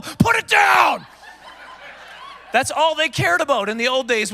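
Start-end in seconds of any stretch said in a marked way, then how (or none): 0.0-1.1 high, drawn-out yelled command; alarmed guard's shout. Retold or imitated. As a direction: bellow in hammed-up alarm at contraband. Border guard suddenly alarmed by fruit.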